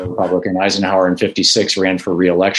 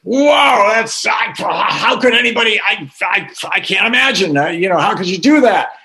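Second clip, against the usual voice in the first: shocked disbelief; 11 semitones higher than usual. Retold or imitated. American reporter to German businessman, stammering shocked disbelief. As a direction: sputter in disbelief like you can't fathom it. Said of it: "the American reporter was like".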